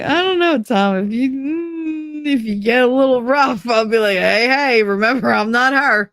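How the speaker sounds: high, hesitant mock-nervous voice; mock worried teasing. Teasing partner about his conviction, high mock-nervous hesitation. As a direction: pretend to be nervous of him.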